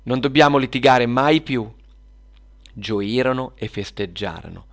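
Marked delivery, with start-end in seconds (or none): 0.0-1.7 loud, heartening resolve; rallying. Acted. Girls vow to stop quarrelling, rallying each other. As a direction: declare it firmly to the whole group. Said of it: "si fecero forza le bambine".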